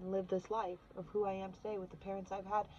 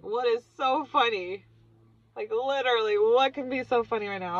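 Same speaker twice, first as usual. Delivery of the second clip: incredulous demand; 3 semitones higher, 9 dB louder than usual. Retold or imitated. Acting out the puzzled shaman.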